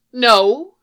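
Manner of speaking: sharp loud no; woman stopping Matt from leaving; firm no. A narrator's reading. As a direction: cut him off. Woman refuses to let him leave.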